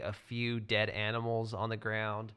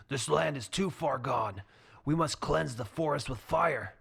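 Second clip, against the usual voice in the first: harsh druid; 4 semitones higher than usual. Acted. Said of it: "a rough, harsh voice saying".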